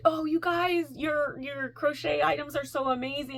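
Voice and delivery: high, gushing compliment; gushing admirers. Retold or imitated. Festival crowd to crocheters, gushing. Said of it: "everybody was like".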